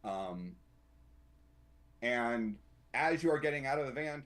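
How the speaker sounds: ordinary talk, nothing marked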